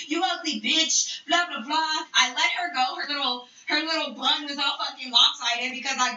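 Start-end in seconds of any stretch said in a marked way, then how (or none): 0.1-2.0 mildly mocking imitation of insults; trash talk. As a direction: toss off slightly raised, slow insults. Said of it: "she was talking so much shit".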